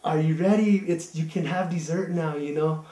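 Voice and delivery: put-on high and loud, fussing over a skinny boy; grandma urging the guest to eat; doting grandma. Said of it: "she said".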